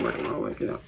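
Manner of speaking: breathy whisper, repeating under his breath; whispered to himself. Real, not acted. Reassuring himself he's working on his issues, whispered. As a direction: murmur it like a private mantra.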